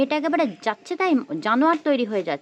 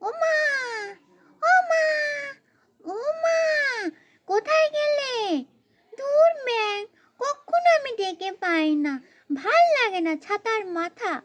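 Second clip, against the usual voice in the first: put-on child calling mother; 10 semitones higher than usual. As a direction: call out for mum, then grumble. Child calls for missing mother.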